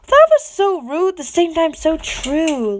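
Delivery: high loud reaction; excited outburst. Real, not acted. Reader reacting to the chapter, suddenly loud. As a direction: blurt out your reaction to the chapter. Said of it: "reader's own reaction".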